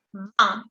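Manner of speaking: "Vingt" nasal, sin g ni t; "Vingt" como "ván" nasal. Shown as a demonstration. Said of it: "pero no la G". ("Vingt" sin pronunciar la G)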